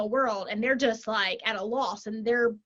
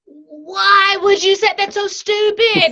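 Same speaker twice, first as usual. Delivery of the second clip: slow and louder, raised in pitch, every word spelled out; a manager scolding someone, slow and loud; exasperated scolding; 8 semitones higher, 10 dB louder, slower than usual. Retold or imitated. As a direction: scold a colleague in mock disbelief, dragging it out.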